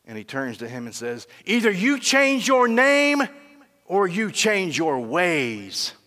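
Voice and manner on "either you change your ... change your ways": king raging at the soldier, slow and loud; enraged king. Acted. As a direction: lay down the ultimatum, each word weighed. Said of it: "gets in this rage".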